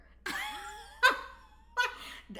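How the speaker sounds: high-pitched, loud burst of laughter; helpless high laugh at your own confession; real laughing. Real, not acted. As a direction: crack up in a high, squealing laugh. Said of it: "I'm only laughing".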